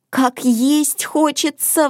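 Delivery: high, slow, mild lament; sighing complaint. A narrator's reading. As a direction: draw it out as a high little complaint. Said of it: "вздохнула Мона".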